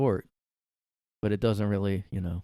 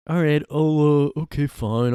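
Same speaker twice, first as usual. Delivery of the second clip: loud, high, drawn-out surrender; exasperated put-on agreement; mock reluctant giving-in; 7 semitones higher, 9 dB louder than usual. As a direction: stretch it out in hammed-up reluctance. Acting a reluctant boyfriend.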